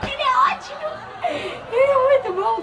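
high, laughing delight. Real, not acted. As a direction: squeal it out while laughing. Laughing at boyfriend's reply.

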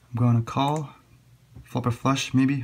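ordinary delivery

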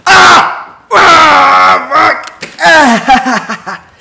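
high, very loud screaming; real pain scream. Real, not acted. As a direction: scream and curse through the jolt. Second self-given electric shock.

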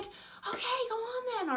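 very high, encouraging star voice; put-on pop-star pitch, inviting. Star invites the fan's story, high impression. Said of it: "And a bit more [name]-y".

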